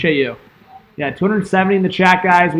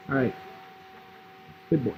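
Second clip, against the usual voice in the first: ordinary delivery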